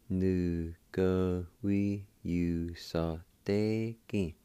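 syllables strung together very slowly; teacher slowing the word right down; slow blended demo. Shown as a demonstration. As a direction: stretch the word out slowly for learners.

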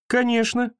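put-on girl answers with a smile; smiling girl. A narrator's reading. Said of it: "улыбнулась девочка".

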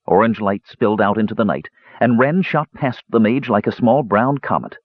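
ordinary delivery, nothing marked

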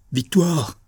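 startled cry of a name, pitched high; high exclamation with a falling end; exclaimed cry. A narrator's reading. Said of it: "s'écria-t-il".

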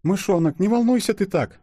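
high, fussing wife's voice; calm your husband in a high little voice; soothing wife. A narrator's reading. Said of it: "кинулась успокаивать благоверного Катенька". (успокаивающая жена, высокий голос)

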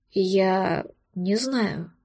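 very slow, low, soft male voice; shaken dragon. A narrator's reading. Dragon answers the heroine slowly, at a loss. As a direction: drag it out, lost and unsure.

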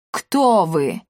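growled ghostly demand; growling. A narrator's reading. Senior ghost questioning intruders, growling. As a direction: growl it as a menacing challenge. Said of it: "прорычал старший призрак".

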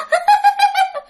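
very high-pitched eerie laugh; piercing possessed-woman laugh; ghostly cackle. Acted. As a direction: cackle shrilly as the spirit. Ghost laughs after demanding child.

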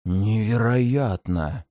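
hoarse, slow forced-out word. Acted. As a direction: squeeze it out hoarsely. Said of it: "сипло выдавил он из себя".

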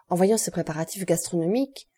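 plain reading voice

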